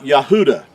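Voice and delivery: "Yahuda" with a long oo and no W; Hebrew-style pronunciation. Shown as a demonstration. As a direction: say "Yahuda" as ya-HOO-da. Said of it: "is how you would pronounce".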